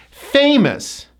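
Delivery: "Famous" with high FAY, dropping -mus; first-syllable stress. Shown as a demonstration. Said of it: "First syllable gets the stress".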